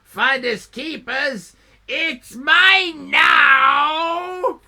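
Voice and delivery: smug croc claiming the trolley; raspy crocodile. Acted. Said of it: "still raspy".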